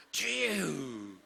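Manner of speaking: long drawn-out wail to the Lord; loud prayer cry. Shown as a demonstration. Believer praying at midnight in an apartment, long loud cry. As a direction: cry it out, stretching it very slowly. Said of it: "열두 시에 아파트에 사시는 분이".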